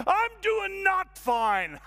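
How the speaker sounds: high, loud, drawn-out outburst; shouted admission. Acted. Man finally admits he is struggling, loud shout. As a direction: yell it slowly across the whole room. Confession bursts out to the room.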